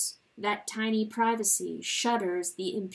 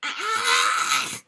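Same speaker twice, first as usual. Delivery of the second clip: high, loud despairing wail; anguished cry; 11 semitones higher, 11 dB louder, slower than usual. Acted. Chanticleer crying out alone in anguish. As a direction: cry out in torment to the dark.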